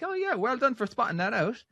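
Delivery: high-pitched imitation of an Australian AI; Australian accent. Gemini answering a question about its accent, Australian accent. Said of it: "is that an Australian accent?".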